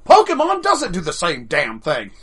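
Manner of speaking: indignant shout. Real, not acted. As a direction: throw it back, offended. Indignant repeat of quoted line.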